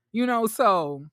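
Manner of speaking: usual speaking voice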